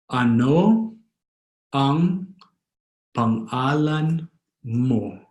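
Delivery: Ano, ang, pangalan, mo, each set apart; drawn-out words with pauses between; slow, word by word. Shown as a demonstration. Teacher teaching how to ask a name, slowly word by word. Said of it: "Or fast, as a Filipino would".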